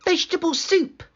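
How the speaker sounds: put-on high, bright girl voice; girl tells the wolf about the soup. A narrator's reading. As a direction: answer like an innocent young girl.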